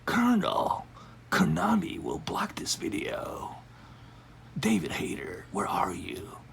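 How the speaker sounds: whispered soldier impression; hushed, slow covert-agent voice; Solid Snake voice. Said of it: "I haven't done a Solid Snake voice in a while".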